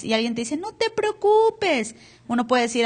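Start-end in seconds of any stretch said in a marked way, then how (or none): 0.6-1.9 drawn-out, high, mock-soothing "No te preocupes"; dismissive comfort. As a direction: parody a friend's empty reassurance. Mocking empty reassurance.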